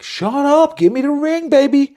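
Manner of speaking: high, loud mock demand from the woman. Woman to partner, a hammed-up high demand for the ring. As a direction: squeal it like she wants the ring now.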